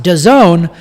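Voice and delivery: "dazone" slowly, as a single word; pronounced as one word. Shown as a demonstration. Host repeats the name for listeners, "dazone" as one spoken word. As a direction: say the name "dazone", not its letters. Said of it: "But it's pronounced dazone".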